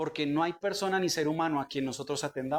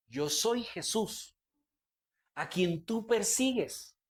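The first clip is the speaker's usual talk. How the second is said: slow, measured scripture voice; voice of Jesus; 3 semitones higher, slower than usual. Acted. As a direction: let each word land slowly, as a solemn reply. Voz de Jesús leída del pasaje. (voz pausada y solemne de Jesús)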